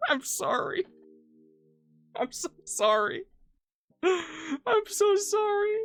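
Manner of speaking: high, slow apology through laughter; laughing apology. Real, not acted. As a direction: wheeze out sorry while cracking up. Laughing fit after joke.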